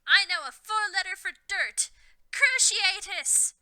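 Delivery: high, loud scream, curse word dragged slow. A narrator's reading. Harry casting a curse, high loud scream. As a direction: fling the spell out with a shriek. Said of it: "screamed Harry".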